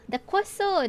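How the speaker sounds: high, delighted "queso"; excited. Real, not acted. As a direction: gush over the queso.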